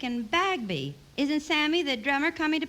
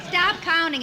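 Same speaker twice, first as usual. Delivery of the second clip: sharp raised-voice scold; scolding call; 6 semitones higher, 4 dB louder, slower than usual. Acted. Wife scolding Jack for counting, sharp raised call.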